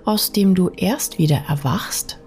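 ordinary delivery

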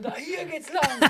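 high, slow, smug pointing the way; imitating a cocky running partner, high and slow; mock arrogant. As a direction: play the cocky guide, drawn out and lofty. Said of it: "So wie arrogant".

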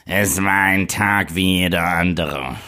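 lightly voiced German trailer announcer; German movie trailer voice. Shown as a demonstration. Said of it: "und einmal in der deutschen".

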